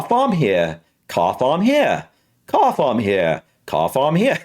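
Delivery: British non-rhotic pronunciation drill; British accent. Shown as a demonstration. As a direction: repeat the words in a British accent, dropping the R. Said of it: "And for British".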